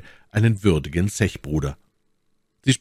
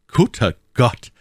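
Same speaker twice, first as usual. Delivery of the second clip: higher, louder, drawn-out amused cry; understated amusement in a raised voice; laughing exclamation; 6 semitones higher, 5 dB louder than usual. A narrator's reading. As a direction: exclaim it slowly with mock dismay. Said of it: "rief er lachend".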